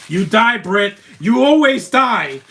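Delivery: loud, high taunting yell, slow on always; mocking shout. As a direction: jeer at him like a rival fan. Taunting a movie character on screen.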